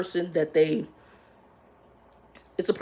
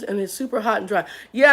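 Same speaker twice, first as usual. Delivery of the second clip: ordinary talk, nothing marked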